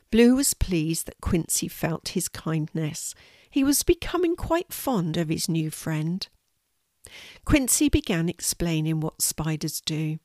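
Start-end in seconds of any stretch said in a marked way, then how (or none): none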